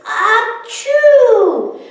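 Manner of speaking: high loud mock achoo; gigantic sneeze. Snowman sneezing hugely for the kids, loud achoo. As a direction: sneeze it big. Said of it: "The snowman sneezed a gigantic".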